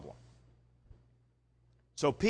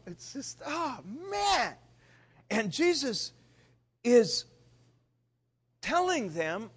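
normal talking voice

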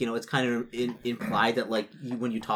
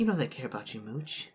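tender; 7 dB quieter than usual. Acted. NPC tender line to lover.

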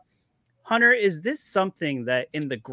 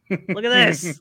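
louder, higher show-off; excited; 5 semitones higher, 7 dB louder, much slower than usual. Real, not acted. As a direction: call them to look, excited.

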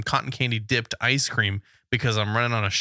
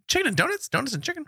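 high sing-song; faster than usual. Retold or imitated. Weighing the word order aloud.

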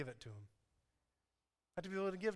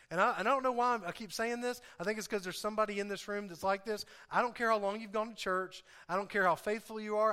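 booming motivational speech; 4 semitones higher, 3 dB louder than usual. Acted. Preaching at full force.

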